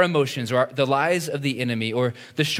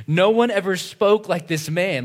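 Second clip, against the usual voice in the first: marveling; 7 semitones higher, 5 dB louder than usual. Retold or imitated. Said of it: "the officers answered".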